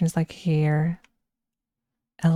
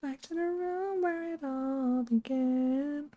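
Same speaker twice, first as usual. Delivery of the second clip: much higher, softer and slow, airy and haunting; eerie high voice; 8 semitones higher, 10 dB quieter, slower than usual. Pretend. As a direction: float it like a ghostly trailer line. Eerie line about Backrooms.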